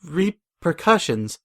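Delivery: slow, halting stammer; nervous stammer. A narrator's reading. Rarity, caught by the mayor, stammers nervously. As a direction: stumble over it in sudden fear. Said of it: "suddenly nervous".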